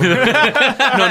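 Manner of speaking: normal talking voice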